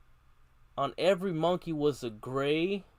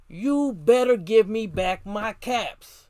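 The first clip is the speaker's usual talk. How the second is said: slow, louder, higher angry demand; quite angry; 4 semitones higher, 6 dB louder, slower than usual. A narrator's reading. Peddler stamping his foot, demanding his caps back angrily. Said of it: "Now he felt quite angry".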